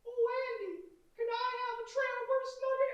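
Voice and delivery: chipmunk voice. Retold or imitated. Actor retelling the puppet's line, high chipmunk voice. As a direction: imitate a silly chipmunk puppet. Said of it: "the microphone operator is doing his chipmunk voice".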